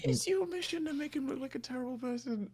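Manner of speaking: mock high voice. Mocking critic's question.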